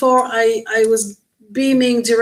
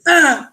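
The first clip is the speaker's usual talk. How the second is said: sharp, louder mock yell; short raised imitation of an angry snarl; imitated creature cry; 5 semitones higher, 4 dB louder than usual. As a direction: act out the annoyed creature's "ah". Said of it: "he was like".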